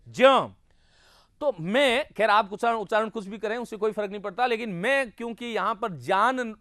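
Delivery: normal talking voice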